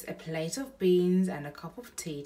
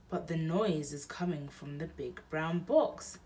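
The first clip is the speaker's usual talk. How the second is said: plain reading voice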